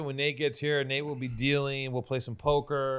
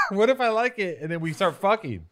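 laughing; 9 semitones higher, 6 dB louder than usual. Real, not acted. Laughing refusal to kiss.

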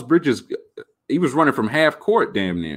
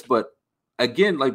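ordinary talk, nothing marked

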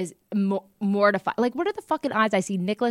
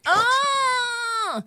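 high startled yelp; startled cry; 12 semitones higher than usual. Real, not acted. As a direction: jump at the scare.

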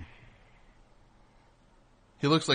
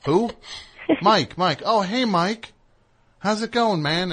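fake friendly greeting; 10 semitones higher, 5 dB louder, slower than usual. Mock fake-friendly greeting to Mike.

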